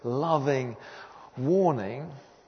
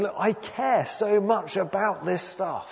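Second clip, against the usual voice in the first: higher, slower, impassioned appeal; mild imitated shout; 7 semitones higher, slower than usual. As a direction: plead God's case emphatically, short of shouting. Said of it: "he's shouting at his people saying".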